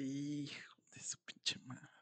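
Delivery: whispered curse. Retold or imitated. Grandmother realizing both are missing, whispered curse. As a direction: swear under your breath. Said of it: "Y dice".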